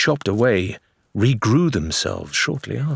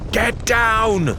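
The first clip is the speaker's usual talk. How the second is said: much higher, urgent warning shout; scream; 14 semitones higher than usual. Acted. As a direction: scream the warning to save them. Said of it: "compelled her to scream".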